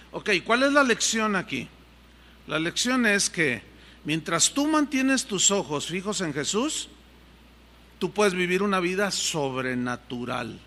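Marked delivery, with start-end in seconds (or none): none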